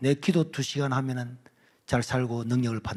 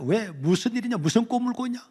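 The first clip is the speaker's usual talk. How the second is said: alarmed, higher questions; startled mother; 8 semitones higher than usual. Retold or imitated. Mother woken by crying son, alarmed questions. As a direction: ask in sudden alarm.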